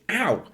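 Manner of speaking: high, small "ow"; mock cry of pain. Shown as a demonstration. Teacher showing brambles hurt, mock pained yelp. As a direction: act out a sudden sting. Acts out being pricked by brambles.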